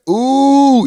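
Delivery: OOH held high and level; long emphasized OOH. Shown as a demonstration. Host teaching the U sound, OOH long and high. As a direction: stretch OOH out on a high note. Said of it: "you gotta like".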